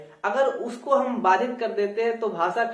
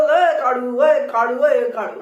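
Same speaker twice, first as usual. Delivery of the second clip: calling out; 7 dB louder than usual. Retold or imitated. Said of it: "तो इस तरीके से नहीं बोल".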